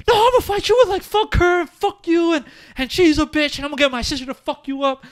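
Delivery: put-on angry drunk yelling. Imitating a mean drunk threatening people at the bar, loud. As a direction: ham up a belligerent drunk picking a fight. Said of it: "people who get that type of drunk".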